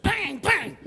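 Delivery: high, explosive vocal gunshot; imitated gunshots. Tow truck driver fires into the air, imitated gunshots. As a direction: mimic two shots ringing out. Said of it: "blasted two shots in the air".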